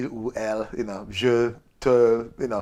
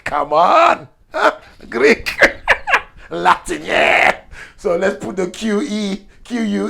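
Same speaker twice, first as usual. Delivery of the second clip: louder, higher cries with a laugh; hammed-up Italian boast; 7 semitones higher, 7 dB louder, slower than usual. Italian brags about Latin roots, hammed-up. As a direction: crow about Greek and Latin. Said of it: "the Italian came along and said".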